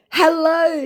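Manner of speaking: high, loud mock greeting; scammer voice. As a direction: overblown fake-friendly greeting. Reads scammer's chat aloud.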